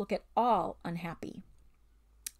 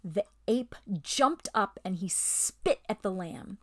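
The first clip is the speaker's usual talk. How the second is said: ordinary delivery, nothing marked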